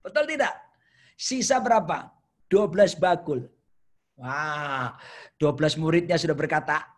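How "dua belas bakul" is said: ordinary talk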